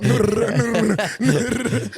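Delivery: high laughing Nur, nur, nur like an alarm; mock alarm. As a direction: imitate a wake-up alarm. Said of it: "You sound like, you know, you're trying to wake up".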